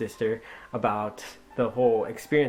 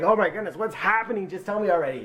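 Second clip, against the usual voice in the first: higher, pressing for an answer; anxious impatience; 7 semitones higher, faster than usual. Retold or imitated. Brother pressing his sister to tell him, impatient. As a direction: demand the news in a raised pitch. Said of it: "she's giving me this emotion".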